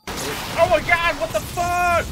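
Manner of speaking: high, loud panicked shriek after a jump scare; startled scream. Real, not acted. Gamer jolted by a jump scare, shrieking high and loud. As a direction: yelps high and loud, scared half to death.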